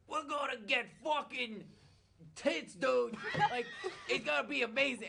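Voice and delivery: high, loud gleeful dude voice; pumped-up party bro, laughing; giddy bro voice squealing with excitement; hyped Duval. Retold or imitated. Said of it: "Duval was like".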